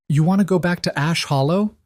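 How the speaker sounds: high-pitched incredulous question; startled query climbing in pitch; voice up an octave. A narrator's reading. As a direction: jump up high in disbelief. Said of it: "Clara's voice went up an octave".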